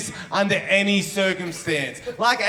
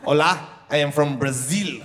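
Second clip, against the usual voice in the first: lower-pitched mock Brazilian accent; 5 semitones lower than usual. Shown as a demonstration. Comic comparing accents for the crowd, mock Brazilian accent. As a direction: put on a terrible Brazilian accent. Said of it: "Three terrible accents".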